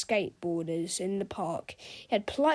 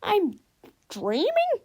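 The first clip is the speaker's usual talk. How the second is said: puzzled; 10 semitones higher, 4 dB louder, slower than usual. A narrator's reading. Teacher puzzled.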